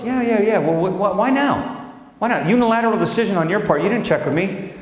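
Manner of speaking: high, fast exasperated wife voice. Retold or imitated. Wife berating the husband, high-pitched and fast. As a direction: rattle off her complaints. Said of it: "I said".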